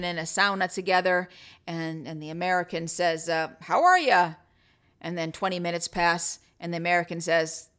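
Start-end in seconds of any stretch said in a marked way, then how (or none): 3.6-4.3 loud, raised-pitch mock greeting; hearty joke greeting. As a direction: call it out like a joke character. Voicing American in a joke.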